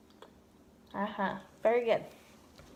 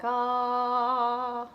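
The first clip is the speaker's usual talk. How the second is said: "ah" sliding up in pitch; changing pitch; 3 semitones higher than usual. Shown as a demonstration. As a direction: glide "ah" upward. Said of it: "change your pitch when you say ah".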